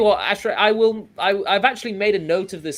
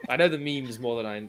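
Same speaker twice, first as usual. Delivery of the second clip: normal talking voice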